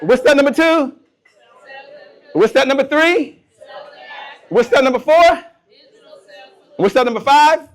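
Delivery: loud, high, rising questions to a crowd; rousing quiz call. Real, not acted. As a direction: call out each step for the audience to shout back. Quizzing the audience, call and response.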